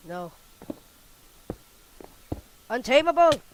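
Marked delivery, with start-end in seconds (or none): 2.7-3.4 excited yell. Real, not acted. Cheering in game fight.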